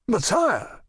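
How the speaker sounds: high, pleading exclamation; a sharp high protest, pitched well up; exclaiming. A narrator's reading. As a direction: cry out in protest to the king. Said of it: "exclaimed Athos".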